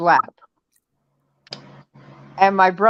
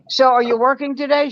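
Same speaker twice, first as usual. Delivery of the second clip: high, chirpy dad voice; cheerful morning question; 7 semitones higher than usual. Retold or imitated. Dad needling his daughter who hates mornings, cheerful. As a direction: keep it brightly upbeat and high-pitched. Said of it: "Your cheerfulness is eating me alive".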